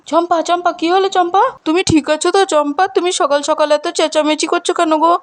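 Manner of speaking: high, anxious calls; calling out. Acted. Husband calling Champa after her scream, urgent. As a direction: call her name urgently from afar.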